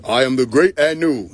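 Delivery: pompous, emphatic proclamation; mock grand deity. Self-proclaimed god before admitting asthma, mock grandiose. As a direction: declare it like a self-important god.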